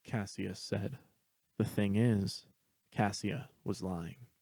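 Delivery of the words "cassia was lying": ordinary delivery, nothing marked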